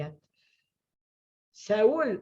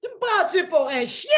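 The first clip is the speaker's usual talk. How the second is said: angry shout; 11 semitones higher, 5 dB louder than usual. Acted. Goliath taunting David.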